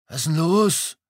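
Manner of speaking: high, thin little voice; tiny breathed voice. A narrator's reading. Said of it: "hauchte er mit winziger Stimme".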